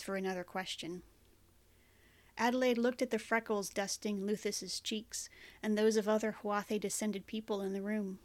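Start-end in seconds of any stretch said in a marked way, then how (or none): none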